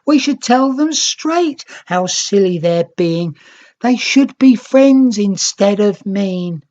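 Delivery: put-on a child's indignant chatter; child character voice. A narrator's reading. Young Nicks scolding the grumpy Reds, indignant.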